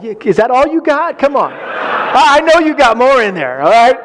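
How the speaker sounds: mock pep talk, high and egging her on; playful coaxing. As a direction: goad her like a coach, in jest. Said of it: "So I start to coax her".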